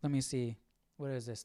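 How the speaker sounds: ordinary talk, nothing marked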